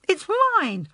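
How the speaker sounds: put-on high-pitched little boy crying out; piping child's cry. A narrator's reading. Little boy claiming his soldiers from the hall, piping cry. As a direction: pipe up in a small, very high voice. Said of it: "cried Rupert, his little voice piping up".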